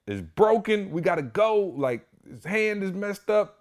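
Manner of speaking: high frantic retold panic; panicked. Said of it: "yo, I'm so panicked".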